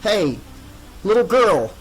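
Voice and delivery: high, loud, shaky call; quavering call. A narrator's reading. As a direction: call out to her in fear. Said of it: "Devon called to the jump roping child in a quavering voice".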